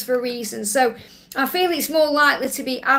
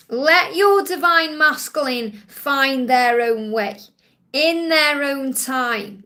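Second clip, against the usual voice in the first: slow, deliberate message; 3 dB louder, slower than usual. Acted. Slow emphatic channelled message.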